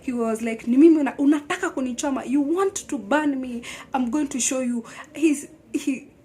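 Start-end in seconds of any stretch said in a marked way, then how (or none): 0.7-4.8 fast, loud furious outburst at her; enraged husband. Retold or imitated. Husband threatening his wife in a fast, loud fury. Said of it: "now a wild animal".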